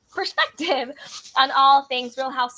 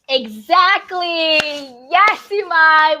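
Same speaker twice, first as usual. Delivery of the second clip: louder, drawn-out, emphatic yes; excited agreement; 3 semitones higher, 9 dB louder, slower than usual. Real, not acted. Host cheering a viewer's comment, loud and excited.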